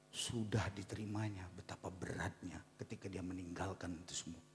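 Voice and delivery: whisper. Real, not acted. Confiding sad story.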